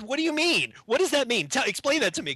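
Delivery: baffled demand. Retold or imitated. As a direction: beg him to explain, voice up high. Demanding explanation from boss.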